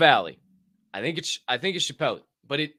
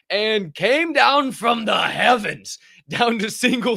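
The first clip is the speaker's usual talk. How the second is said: booming slow proclamation; dramatic shout; 8 semitones higher, 6 dB louder, slower than usual. Pretend. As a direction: boom it out like an announcer. Dramatic price drop.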